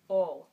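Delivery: O said long with round open lips; O vowel shape. Shown as a demonstration. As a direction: round the mouth into O. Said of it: "think about saying the word wo".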